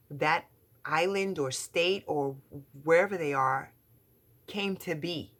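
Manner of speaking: rising indignation at lazy tourists; scornful, insistent build; emphatic rant. Real, not acted. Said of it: "that's not me".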